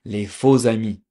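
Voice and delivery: les faux amis said as lay foh-za-mee; les faux amis in French, z linking faux to amis; French pronunciation. Shown as a demonstration. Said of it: "There's something called".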